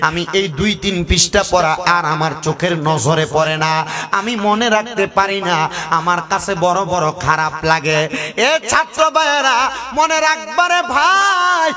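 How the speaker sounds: melodic waz preaching. Acted. Preacher tells congregation of a weeping grave, chanted sermon. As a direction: preach the tale in singsong, stretching the line endings.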